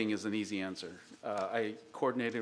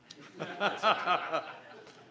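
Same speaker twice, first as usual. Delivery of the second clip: real laugh; 8 semitones higher than usual. Real, not acted. Laughs after an engineer joke.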